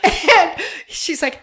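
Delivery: usual speaking voice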